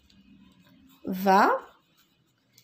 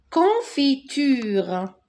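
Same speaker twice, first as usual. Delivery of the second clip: confiture drawn out slowly, syllable by syllable; slow model word; 4 semitones higher, much slower than usual. Shown as a demonstration. Teacher modelling confiture slowly for young pupils. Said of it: "teacher models word".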